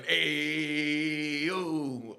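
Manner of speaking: loud, drawn-out singsong holler; crowd yell. Retold or imitated. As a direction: imitate everybody yelling in a long chant. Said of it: "everybody start yelling".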